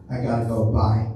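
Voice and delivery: normal talking voice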